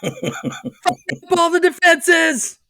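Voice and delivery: high, loud shouted commands; hammed-up commander yelling orders; mock battle orders. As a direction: bark orders like a panicked captain.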